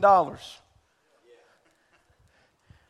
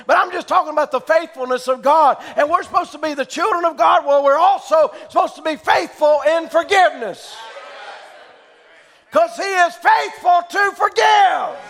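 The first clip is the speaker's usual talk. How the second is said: raised, ringing preacher's voice building to a shout; preaching at full force; 7 semitones higher, 5 dB louder than usual. Acted. Preacher calling out to his church, fervent and loud. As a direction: preach it out to the congregation, rising.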